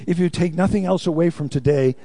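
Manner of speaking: ordinary delivery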